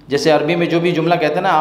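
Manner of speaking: normal talking voice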